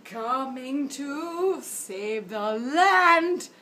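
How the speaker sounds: grand proclamation. Pretend. Heroic unicorn proclamation.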